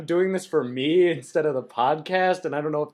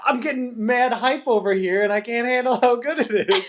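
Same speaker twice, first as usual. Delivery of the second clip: higher, louder, laughing hype; hyped up; 9 semitones higher, 6 dB louder than usual. Retold or imitated. Co-host voicing her own hype about the book, laughing. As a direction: gush like a giddy fan. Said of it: "'Cause I'm just like".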